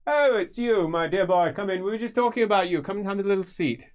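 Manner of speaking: high, welcoming mage voice; high and friendly, a touch oily; Feuerbach, genial. Acted. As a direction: greet him like a charming old friend. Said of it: "looks and says".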